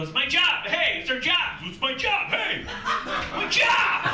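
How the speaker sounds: loud, high American protest; panicking American worker. Acted. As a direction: shout like an American frantic about work. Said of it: "in the States, people go".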